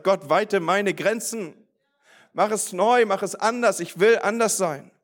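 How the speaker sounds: retold urgent prayer. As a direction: lift the pitch and plead, re-enacting the prayer. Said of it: "halte mich an diesem Lenkrad fest".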